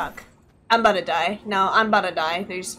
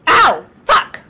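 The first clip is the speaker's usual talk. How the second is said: sudden loud cry of pain; real pained yelp; 6 semitones higher, 9 dB louder than usual. Real, not acted. Gamer whose bird pulls their hair, loud pained yelp. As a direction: yelp as your hair gets yanked.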